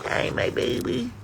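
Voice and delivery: breathy high coo to a pet; sweet nothings. Real, not acted. Greeting chipmunk softly.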